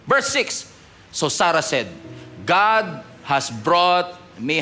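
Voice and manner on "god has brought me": slow, weighty reading; stressed words. Shown as a demonstration. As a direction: lean on each word. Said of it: "highlight that part". (binibigyang-diin)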